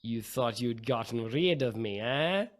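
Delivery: put-on villain's return in a Russian accent. Game master voicing a returning foe to players, Russian accent. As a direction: taunt them with a Russian accent. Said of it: "you hear a familiar Russian accent say".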